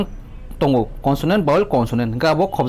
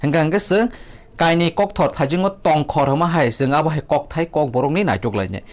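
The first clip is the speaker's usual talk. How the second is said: ordinary talk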